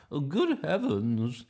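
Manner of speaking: high, loud, slow grandma voice; startled grandma. Acted. Grandma woken by all the noise, loudly startled. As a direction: exclaim it like an astonished granny. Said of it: "she said".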